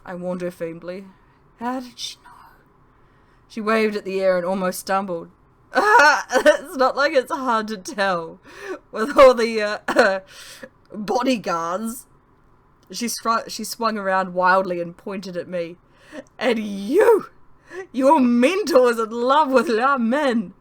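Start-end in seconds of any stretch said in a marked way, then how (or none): 1.6-2.3 whisper. Acted. Stage-whispered question. 5.8-20.6 slow, loud, giggly words; tipsy laughing. Acted. Giddy Lolitha still laughing.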